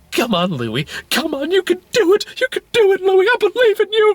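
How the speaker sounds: very high squeaky mock cheer; sideline cheering. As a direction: cheer him on in a high falsetto. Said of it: "everyone's on the sideline, and some are going".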